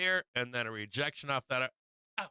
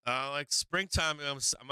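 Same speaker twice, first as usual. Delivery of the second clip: ordinary talk, nothing marked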